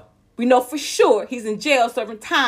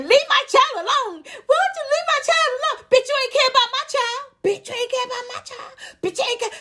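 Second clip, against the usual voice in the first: much higher, fast sneering mimicry of a mother's plea; mocking imitation; 13 semitones higher, faster than usual. [name] mocking another woman's plea for her child, in a high sneering voice. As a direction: ham it up like a cruel mock of someone pleading. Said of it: "[name] mocks her, saying".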